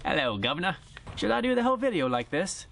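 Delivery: hammed-up British accent, like a cheeky Londoner; playful fake British accent as a quick gag; mock British accent. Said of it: "You could probably now pick up on my British accent".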